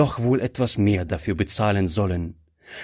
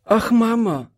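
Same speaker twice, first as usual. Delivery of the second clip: put-on high-pitched little girl calling out; child's cry; 13 semitones higher, 3 dB louder than usual. A narrator's reading. Frightened daughter clings to her coughing mother, crying out. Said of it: "rief Gretchen und umklammerte fest".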